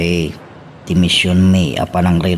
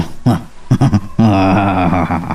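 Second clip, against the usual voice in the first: an uneasy little laugh; awkward nervous chuckle. Acted. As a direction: laugh to cover the nerves. Servant laughs nervously naming Miguel.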